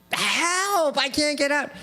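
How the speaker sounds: sustained high cry for help; mock scream. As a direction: call high out of a well, without real volume. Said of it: "hears him screaming".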